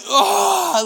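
high loud imitated wail of a sob; imitating his bride's silent ugly cry as a high wail; mock ugly cry. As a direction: ham up a contorted sob. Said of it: "it is the ugly cry".

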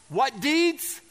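usual speaking voice

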